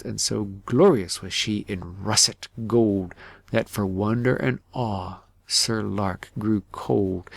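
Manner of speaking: ordinary delivery, nothing marked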